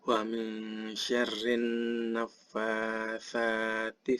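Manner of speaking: slow, measured tartil recitation; slow Arabic chant with drawn-out nasal holds; Quran recitation. Shown as a demonstration. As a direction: recite slowly with long, held vowels. Recites the surah.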